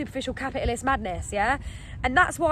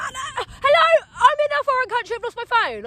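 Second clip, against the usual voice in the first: very high, loud wailing plea; frantic, hammed-up protest; re-enacted desperation; 12 semitones higher, 5 dB louder than usual. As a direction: call after them in mock panic. Re-enacts pleading after police leave.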